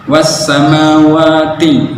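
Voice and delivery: slow sung was samawati; correct reading. Shown as a demonstration. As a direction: chant was samawati properly. (was samawati dibaca benar)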